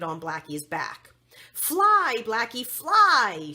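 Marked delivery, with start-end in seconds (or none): 1.5-3.5 loud, high start then slow drawn-out urging; rallying cry. A narrator's reading. The princess urges her pony onward in a loud rallying cry. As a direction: spur the pony on with a loud call. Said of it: "she said".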